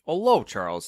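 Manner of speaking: lightly voiced Cockney, high and loud; chirpy Cockney. A narrator's reading. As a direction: greet with a touch of chirpy Cockney. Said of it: "with a chirpy Cockney accent".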